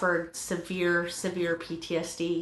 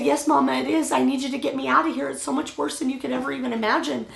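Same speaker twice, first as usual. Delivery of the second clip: higher, fast, frantic begging; desperate plea; 6 semitones higher, faster than usual. Retold or imitated. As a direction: plead fast and high like a terrified girl. Daughter begs to be rescued.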